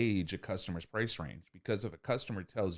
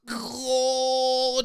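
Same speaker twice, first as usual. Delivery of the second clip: much higher, louder mock moan; mock cry; 12 dB louder than usual. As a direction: overeager groan. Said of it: "because you're like".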